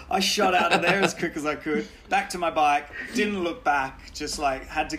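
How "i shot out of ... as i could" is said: ordinary talk, nothing marked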